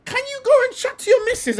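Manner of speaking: exasperated. Real, not acted. Exasperated rant at footballer.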